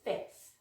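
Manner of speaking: FIFTH as a soft, isolated "fith"; silent middle F. Shown as a demonstration. Teacher has learners repeat, silent middle F. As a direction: model "fifth" softly, second F left out. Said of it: "So try that after me".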